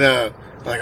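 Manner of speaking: usual speaking voice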